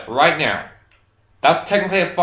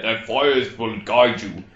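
put-on lower, slow solemn old man; old grandfather voice; 5 semitones lower, slower than usual. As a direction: intone it like a wise elder. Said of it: "my superstitious grandfather".

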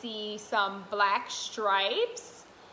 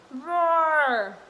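louder mock lion roar; 4 semitones higher, 7 dB louder than usual. Shown as a demonstration. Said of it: "Can you roar like a lion".

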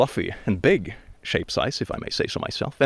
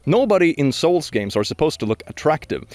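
usual speaking voice

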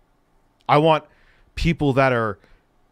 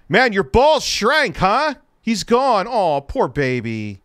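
mocking jeer; 7 semitones higher, 4 dB louder, slower than usual. Real, not acted. Mocking departed guest.

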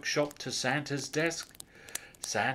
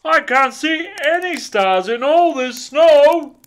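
loud, higher protesting Santa; blustering Santa; 14 dB louder than usual. A narrator's reading. Santa arguing with the satnav, blustering. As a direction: bluster indignantly. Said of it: "Santa blustered".

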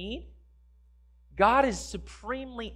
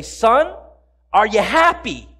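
loud, punchy call with raised pitch; 8 dB louder, slower than usual. Acted. Father asks his son across the roof, calling out. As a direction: shout the question across to your son.

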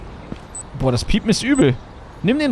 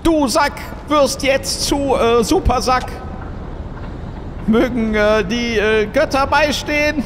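solemn priest; 7 semitones higher, slower than usual. Acted. Mock blessing of sack.

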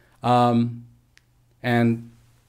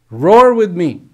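loud, higher rallying cry; rousing call; 5 semitones higher, 10 dB louder than usual. Acted. Big lion rouses the cub to roar, loud call. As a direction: call him to roar with you.

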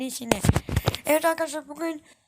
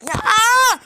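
high loud anguished wail; frustrated scream; 9 semitones higher, 13 dB louder than usual. Real, not acted. Gamer failing again, loud anguished wail. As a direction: wail at yet another failure.

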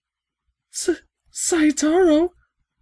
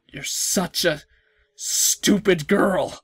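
low, slow sneering villain; menacing villain; 6 semitones lower, slower than usual. Acted. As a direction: drag out the insult like a madman. Villain voice taunting the girl.

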